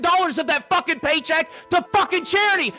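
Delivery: real furious shouting rant, voice rising. Real, not acted. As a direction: yell it at him, panicking about the bills. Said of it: "Dude, calm down".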